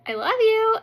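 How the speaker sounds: high, loud, pleading coo; wheedling affection. Retold or imitated. Wife appeasing annoyed husband, high wheedling. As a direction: pitch it up to win forgiveness.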